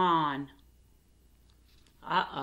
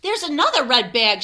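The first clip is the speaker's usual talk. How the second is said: louder, a bit higher call; excited shout; 4 semitones higher, 6 dB louder than usual. Acted. Alexander spotting another red bag, a louder excited call. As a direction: call out the discovery. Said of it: "shouts Alexander".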